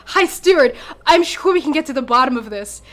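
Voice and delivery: high, fast nervous chatter with a laugh; nervous laughing fib. Acted. Terrified tiefling pleading with the High Steward, fast nervous laugh. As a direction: talk fast to smooth over the lie. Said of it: "he looks terrified... smile on".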